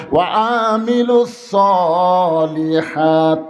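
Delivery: slow, sung-out sermon line; intoned recitation. Real, not acted. Preacher announces the surah's second point, intoned recitation. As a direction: stretch the Arabic phrase into a chant.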